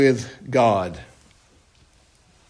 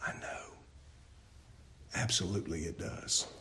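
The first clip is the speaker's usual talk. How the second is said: near-whispered, falling away to nothing; sheepish admission; 11 semitones lower, 24 dB quieter, faster than usual. Pretend. Congregant conceding under their breath in a near whisper. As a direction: mumble it like a caught listener. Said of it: "And your response is".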